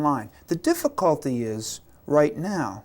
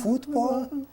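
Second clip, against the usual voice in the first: high, hopeful "Football?"; a little boy asking for a football, high and hopeful; 11 semitones higher, 3 dB quieter than usual. Retold or imitated. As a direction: plead for the ball like a small kid. Boy begging for ball.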